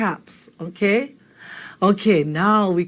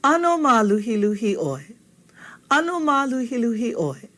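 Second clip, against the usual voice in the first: "Ano ma luhi luhi oi" peaking in the middle and dropping at the end; question tune; 2 semitones higher than usual. Shown as a demonstration. Teacher repeats the model question for the class, question tune. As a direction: ask "Ano ma luhi luhi oi" with a lifted middle and falling "oi". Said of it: "So if you're asking that question".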